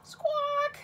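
high squawking bird call. Pretend. Teacher imitating the oyster catcher, high squawk. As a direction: squawk like a shorebird.

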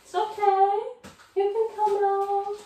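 very high sing-song coaxing. Real, not acted. Talking to a scared mouse in the cupboard, coaxing. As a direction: coax it out in a high little voice.

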